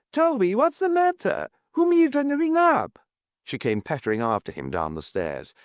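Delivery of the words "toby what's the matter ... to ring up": very high, agitated questioning; crying out. A narrator's reading. Landlady asking whom he is ringing, high cry. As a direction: demand answers in a high, alarmed voice. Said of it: "cried Missus Worth".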